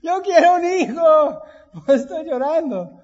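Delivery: high, loud mock wife's outburst; exasperated wife. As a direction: cry it out shrilly like the wife who wants a child. Wife answers husband's logic.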